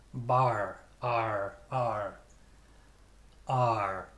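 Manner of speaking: American accent, sounded r in "Bar"; American-style "ar", r held after the vowel. Shown as a demonstration. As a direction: draw out "ar" with an American r. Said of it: "American accent".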